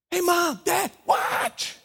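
put-on high, eager kid calling out; child calling. Child at the pool calls to mom and dad, high. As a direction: call for attention like an excited child.

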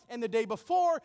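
usual speaking voice

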